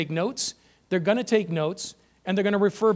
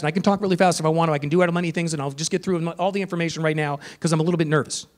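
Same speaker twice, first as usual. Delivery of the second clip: rushed, rattling the words off fast; talking really fast; 2 semitones lower, faster than usual. Shown as a demonstration. Trainer shows teachers the rushed delivery, talking very fast. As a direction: race through it like a nervous presenter. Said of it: "start talking really fast".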